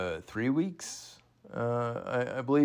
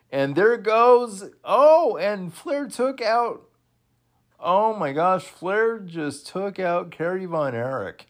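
much higher, louder frantic play-by-play; excited shout; 8 semitones higher, 5 dB louder than usual. Real, not acted. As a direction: yell it out as the chaos unfolds. Chaotic elimination watched live.

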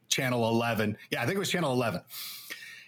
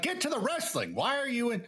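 much higher, mock-whiny get on with it; mock impatient audience; 12 semitones higher than usual. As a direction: play the fans pushing him along, high and whiny. Imagined impatient viewers.

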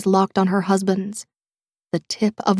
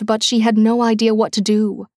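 ordinary delivery, nothing marked

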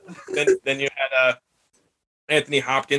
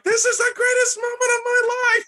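put-on excited kid voice. Kid thrilled in ad.

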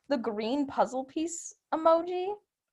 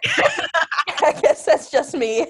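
laughing through the words; 4 semitones higher, 5 dB louder, much slower than usual. Real, not acted. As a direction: giggle it out slowly. Laughing while talking.

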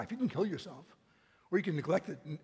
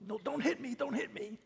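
mock cowering plea; 8 semitones higher than usual. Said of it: "it's not the trembling of".

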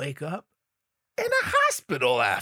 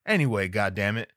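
ordinary talk, nothing marked

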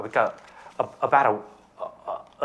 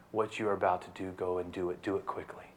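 low, soft words to Judas; hushed command; 5 semitones lower, 9 dB quieter than usual. Acted. Jesus quietly dismissing Judas, hushed. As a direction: say it quietly, almost privately.

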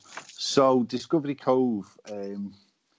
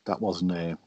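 ordinary delivery

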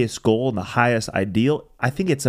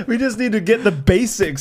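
laughing; 10 semitones higher, 3 dB louder than usual. Real, not acted. Laughs at his own point.

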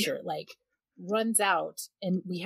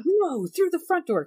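high, urgent mock yell; retold frantic command, pitched up; husband ordering intruder; 12 semitones higher, 4 dB louder than usual. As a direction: redirect them loudly, imitating an exasperated husband. Husband yelling directions at intruder.

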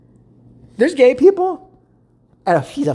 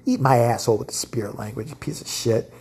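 ordinary talk

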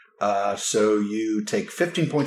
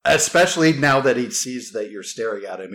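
ordinary talk

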